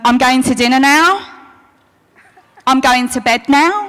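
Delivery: each statement tilts up at the end on "now" like a question; bored teen making every sentence a question; rising upspeak. Retold or imitated. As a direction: mimic teen upspeak, ending "dinner now" and "bed now" on a rise. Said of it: "going up at the end of a sentence".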